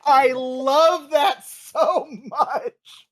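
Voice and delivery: loud, high, drawn-out enthusiasm; real gushing delight. Real, not acted. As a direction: gush it slowly, savouring every word. Gushing over the quoted monologue.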